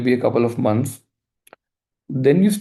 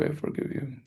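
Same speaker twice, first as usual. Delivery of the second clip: whisper; 14 dB quieter than usual. Acted. Repeated mantra fading to whisper.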